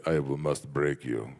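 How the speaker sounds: low, slow Russian movie-villain menace; Dolph Lundgren. Acted. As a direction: do a deep Russian accent, drawn out. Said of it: "like Dolph Lundgren in the Rocky movie".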